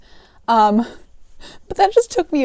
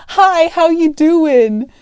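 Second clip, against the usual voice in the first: loud, higher, drawn-out holler; imitated yell; 6 semitones higher, 6 dB louder, much slower than usual. Customer yelling back at the cashier across the store, imitated holler. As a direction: slowly bellow it back like the customer. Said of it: "he yells back at me".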